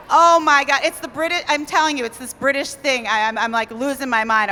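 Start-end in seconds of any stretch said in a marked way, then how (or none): none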